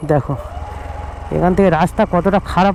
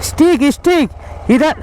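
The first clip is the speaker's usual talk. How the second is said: calling out; 6 semitones higher than usual. Real, not acted. Calling out to someone outside.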